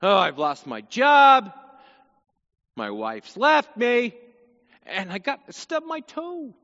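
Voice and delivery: slow, loud, hammed-up moan; mock self-pity. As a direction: whine about every misfortune at once. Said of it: "You just pile everything on".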